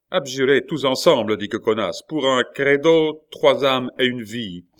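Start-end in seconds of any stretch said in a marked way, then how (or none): 0.1-1.4 loud, raised order; commanding. A narrator's reading. Attacker ordering the family to abjure, loud command. 2.1-4.7 loud, high proclamation, starting slowly; commanding. A narrator's reading. Attacker offering lives for a creed, loud proclamation.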